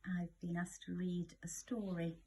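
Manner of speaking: ordinary delivery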